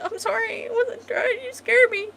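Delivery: very high, mock-tearful protest; whimpering apology. Startled woman he woke says sorry, in a high mock whimper. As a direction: squeak out a hammed-up tearful apology.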